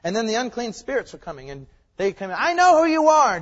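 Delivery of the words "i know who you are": loud, high outcry; crying out. Acted. Unclean spirit confronting Jesus in a sermon, crying out. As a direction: blurt it out at a shout.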